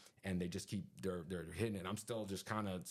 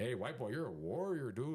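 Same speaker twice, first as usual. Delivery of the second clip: higher, singsong mock Mexican accent; bad Mexican accent; 5 semitones higher than usual. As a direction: ham up a Mexican roommate's accent, badly. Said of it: "I'm not really good at accent, but he's like".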